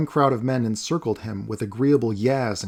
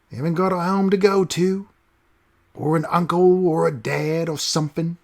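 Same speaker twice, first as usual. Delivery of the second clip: high, coarse Cockney taunt; loud mocking street ruffian voice; rough street thug; 7 semitones higher, 4 dB louder than usual. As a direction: sneer at the boy like a street tough. Stranger taunting the boy.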